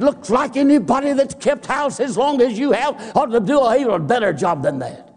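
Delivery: high, sneering preacher husband; smug husband's jab. Retold or imitated. Husband taunting his wife over the flooded floor, sneering. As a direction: needle her like a know-it-all husband.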